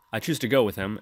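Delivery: ordinary talk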